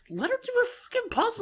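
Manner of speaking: high-pitched exasperated plea; mock exasperation. Fan siding with Jane against Roxy, mock exasperation.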